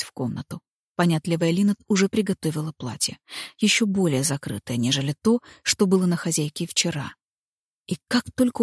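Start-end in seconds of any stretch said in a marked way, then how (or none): none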